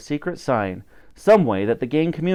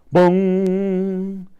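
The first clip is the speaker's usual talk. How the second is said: higher, held boom; booming sound; 6 semitones higher than usual. A narrator's reading. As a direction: let the boom ring out. Sound effect in story.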